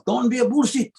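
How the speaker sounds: put-on dad's high-pitched Tongan-accented broken English; mock Tongan-accented fatherly command. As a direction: imitate dad scolding in a thick Tongan accent. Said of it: "I'll just say it in his accent".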